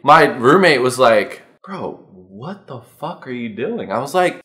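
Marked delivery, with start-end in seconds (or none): 1.6-3.9 slow, soft aside in disbelief; hushed scolding. Acted. Roommate questioning him on the side, hushed disbelief.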